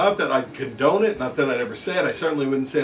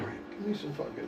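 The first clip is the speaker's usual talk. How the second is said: muttered Tuvok; 15 dB quieter, much faster than usual. Retold or imitated. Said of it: "he walks over".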